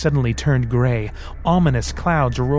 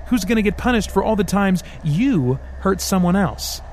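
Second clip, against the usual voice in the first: mocking devil arguing; 6 semitones higher than usual.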